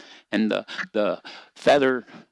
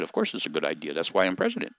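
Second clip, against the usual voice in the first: smug President Dog; 5 semitones lower, 5 dB quieter, faster than usual. Acted. President Dog boasting to Shaman Dog, low and soft. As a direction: lower and softer, self-satisfied.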